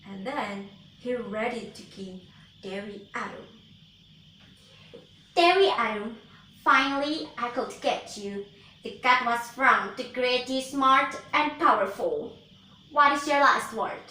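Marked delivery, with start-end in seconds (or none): 5.3-14.1 loud gloating witch. Acted. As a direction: taunt her like a wicked witch. Witch gloats over captive.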